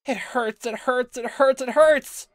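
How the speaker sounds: very high, very loud panicked refusal; terrified screaming. Acted. Girl fighting off the doctor's shot, screaming. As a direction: shriek in pain and terror.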